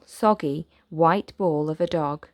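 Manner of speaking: usual speaking voice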